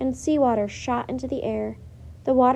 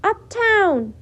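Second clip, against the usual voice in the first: much higher, loud yell; 9 semitones higher, 7 dB louder than usual. A narrator's reading. Girl yelling at the crowd to go uptown, loud. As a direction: fling the word at the crowd. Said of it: "yelled Annie".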